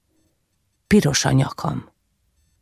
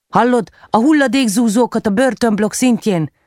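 raised, higher voice, calling urgently for help; 6 semitones higher than usual. Acted. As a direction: shout over the noise before the walls crush you. Urgent call to C-3PO, crushing walls. (sürgető, felemelt hangú kiáltozás)

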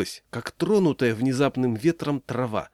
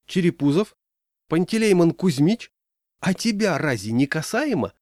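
higher-pitched casual questioning; everyday, mildly surprised; 6 semitones higher, 3 dB louder than usual. A narrator's reading. Leader singling out a shopkeeper, mildly surprised. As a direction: ask innocently, as if puzzled. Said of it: "ровным, будничным голосом, удивлённо даже как бы".

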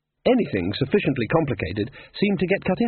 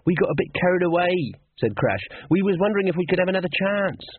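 Crash voiced; 8 semitones higher than usual. A narrator's reading. Crash pleading.

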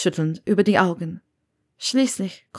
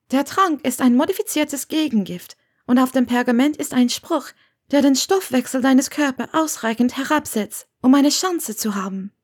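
slightly raised character voice; lightly voiced earnest woman; 7 semitones higher than usual. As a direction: voice a woman carefully explaining herself. Narrator voices Hermione.

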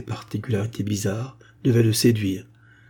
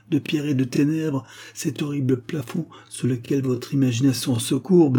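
higher-pitched, earnest lover's plea; impassioned lover; 5 semitones higher than usual. Acted. Blind girl's lover pleading to keep his sight, impassioned. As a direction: plead tenderly for what is cherished.

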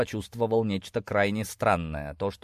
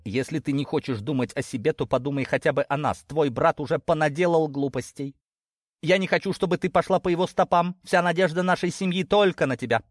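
higher-pitched, earnest lecture; admonishing father; 6 semitones higher than usual. Acted. Father pinning family hopes on daughter, admonishing. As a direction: reason urgently with your child.

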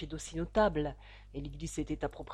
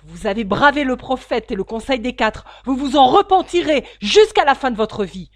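loud, higher, raging warning; furious threat; 6 semitones higher, 17 dB louder than usual. A narrator's reading. Scorned suitor warns the father, furiously loud. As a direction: rage at him about the prophet. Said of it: "s'exclama Stangerson, blême de rage".

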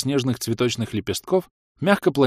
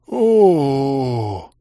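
long, higher drawn-out oh; 4 semitones higher, 5 dB louder than usual. A narrator's reading. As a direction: stretch the oh out in awe. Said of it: "протянул игрок".

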